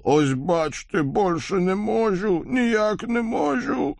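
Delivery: high, slow theatrical croak; demonstrative wheeze. A narrator's reading. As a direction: croak it out, showing off the lost voice. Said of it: "демонстративно прохрипел".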